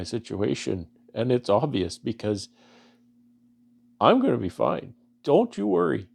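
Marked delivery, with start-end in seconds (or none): 4.0-6.1 higher, louder voice straining against tears; holding back tears while reassuring; choking up. Real, not acted. Reassuring viewers who prayed for him, voice choked. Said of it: "sorry for choking up a bit".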